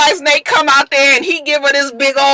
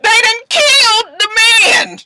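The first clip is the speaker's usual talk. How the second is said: high, slow, loud cry; real anguish, high and drawn out; anguished wail; 13 semitones higher, 4 dB louder, slower than usual. Real, not acted. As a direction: wail it out in shock. Repeats shocked.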